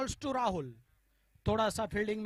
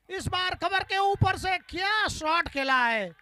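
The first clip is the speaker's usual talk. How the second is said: high, loud shouted boundary call; excited cricket commentary; 4 semitones higher, 4 dB louder than usual. Real, not acted. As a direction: shout the four with delight. Cover drive goes for four. (जोशीली cricket commentary)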